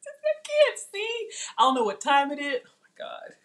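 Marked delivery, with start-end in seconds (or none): 0.1-1.3 high stretched whine. Real, not acted. Struggling to read comments.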